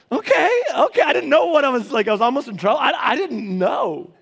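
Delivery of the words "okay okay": relieved okay. Retold or imitated. Relief after hands rise.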